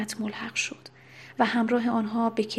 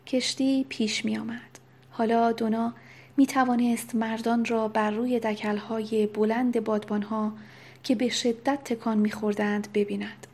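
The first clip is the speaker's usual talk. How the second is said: usual speaking voice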